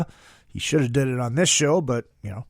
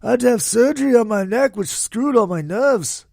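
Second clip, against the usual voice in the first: [name]; 6 semitones higher than usual. Retold or imitated. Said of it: "[name]".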